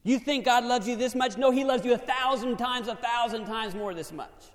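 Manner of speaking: loud, high-pitched preaching. Acted. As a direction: preach it out with fire. Preaching at full force.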